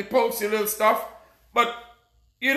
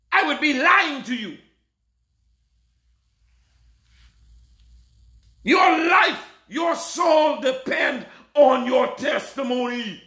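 full-force preaching; 4 semitones higher than usual. Acted. Preacher warning his flock about life and death, shouting. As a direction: thunder warnings at the congregation.

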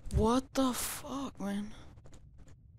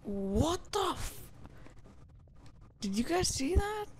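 shocked disbelief; 7 semitones higher than usual. Real, not acted. Shocked by something seen.

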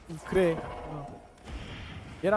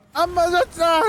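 triumphant taunting shout; 9 semitones higher, 8 dB louder, slower than usual. Real, not acted. Taunting boss after dodging.

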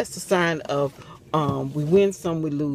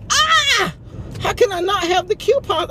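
high, loud retold scream; half-mock scream of disbelief; 5 dB louder than usual. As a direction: relive the panicked scream. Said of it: "I did scream".

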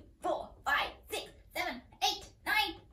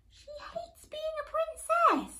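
mock crying; much faster than usual. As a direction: pretend to blubber for the kids. Mock crying about hating princesshood.

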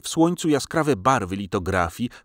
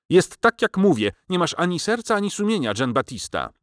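scolding; 9 semitones higher than usual. A narrator's reading. Teresa berating Nostromo, high scolding reproach. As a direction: scold in a raised, much higher voice.

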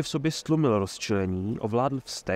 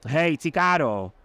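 slow, loud call across the square; 5 semitones higher, 4 dB louder, slower than usual. Acted. Mayor calls Cicada off Geralt, slow loud call. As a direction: drag the name out to call him off. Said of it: "zvolal najednou starosta".

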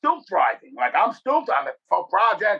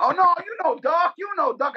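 pleading jailed friend; 11 semitones higher than usual. Retold or imitated. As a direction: imitate a buddy insisting he knows Duck. Jailed friend pleading, name-dropping.

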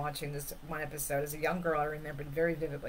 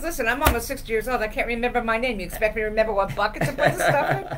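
louder, higher and sputtering, half laughing; retelling an old man's exasperated outburst; agitated retort; 5 semitones higher, 6 dB louder, faster than usual. Retold or imitated. Old man snaps back at the inspector, louder and exasperated. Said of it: "very agitated".

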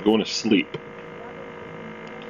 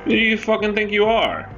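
high mock outrage; 11 semitones higher than usual. Outraged at the Sim.

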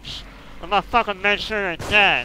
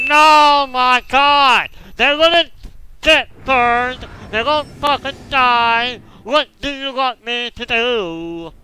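high, loud, exasperated rant; pissed-off yelling; 5 semitones higher, 6 dB louder, slower than usual. Real, not acted. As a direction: rail at the game at a shout. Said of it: "I'm getting pissed off".

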